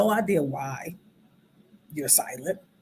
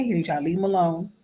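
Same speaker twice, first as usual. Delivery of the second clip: normal talking voice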